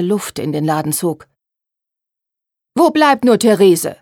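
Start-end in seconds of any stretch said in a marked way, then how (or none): 2.7-4.0 loud, higher, displeased call; indignant impatience. A narrator's reading. Mother in the workroom missing her seamstress, indignant. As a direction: demand it irritably, projecting from the next room. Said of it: "die unwillige Stimme der Mutter".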